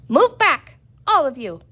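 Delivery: high, loud warning shout. A narrator's reading. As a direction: shout them back. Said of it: "she shouted".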